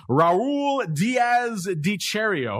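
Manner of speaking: drawn-out, high-pitched Italian pronunciation; stretched Italian flourish on the name. Shown as a demonstration. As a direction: roll the name out slowly, Italian-style. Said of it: "I went for the Italian pronunciation".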